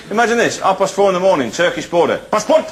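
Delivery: quick, high mock border guard exchange; mock border scene. To the clerk, acting out a border check, hammed up. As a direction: act out a guard asking for papers.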